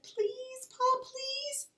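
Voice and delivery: put-on high, whining child's plea; pleading. Little Laura pleads with Pa, high and whining. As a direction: beg Pa in a small high voice.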